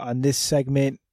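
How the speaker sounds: ordinary delivery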